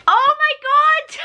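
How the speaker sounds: overjoyed shout. Real, not acted. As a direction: scream with joy at the book. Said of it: "If you can't tell, I was very excited".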